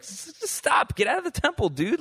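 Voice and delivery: high, alarmed stammer; alarmed priest. Retold or imitated. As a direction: blurt it out like a shocked priest stopping a king.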